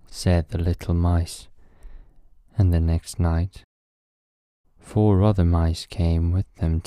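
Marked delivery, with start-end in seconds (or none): none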